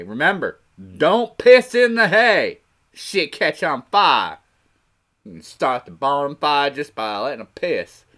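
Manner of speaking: slow country drawl with stretched vowels; hammed-up rural American drawl. Shown as a demonstration. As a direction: draw it out like a barnyard yokel. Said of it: "I do have that little drawl".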